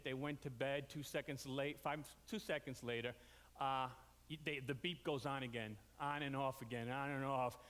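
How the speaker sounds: ordinary talk, nothing marked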